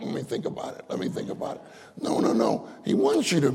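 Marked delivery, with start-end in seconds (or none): 0.0-1.6 hushed, stalling whisper; mock hesitant whisper. Preacher playfully voicing the Lord hesitating, in a whisper. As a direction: whisper it as if mulling it over. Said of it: "He said".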